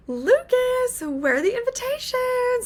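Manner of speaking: high, eager call across the house; excited call. Retold or imitated. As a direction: call out brightly, thrilled. Excitedly asking for invitations.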